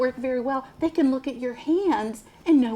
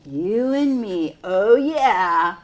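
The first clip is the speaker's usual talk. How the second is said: excited; 2 semitones lower, 5 dB louder, much slower than usual. Acted. Enthusiastic exclamation to children.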